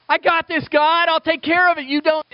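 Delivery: high, loud, breezy self-confidence; cocky brush-off. Retold or imitated. Us telling God we manage alone, high cocky. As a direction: wave God off, I got this.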